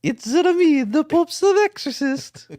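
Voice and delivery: put-on high-pitched hammy Italian accent; Pope's Exorcist accent. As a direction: channel a movie exorcist in mock Italian. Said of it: "when you channel the Pope's exorcist".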